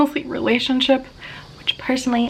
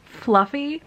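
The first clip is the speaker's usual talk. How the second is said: usual speaking voice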